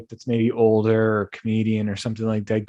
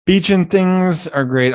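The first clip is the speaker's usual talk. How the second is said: higher, louder and slow, gushing; excited; 8 semitones higher, 7 dB louder, slower than usual. Real, not acted. Host gushing to listeners about beaches, excited and slow. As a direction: savor every word with delight.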